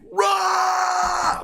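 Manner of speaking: sudden high shouted "Rah!" roar; trader's roar. Retold or imitated. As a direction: bellow it like a pit trader over the crowd. Roar heard on trading floor.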